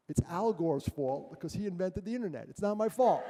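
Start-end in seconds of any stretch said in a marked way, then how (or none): 2.5-3.2 louder, insistent mock denial; louder mock protest. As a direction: push the protest out loud, half joking. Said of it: "mock protest repeated".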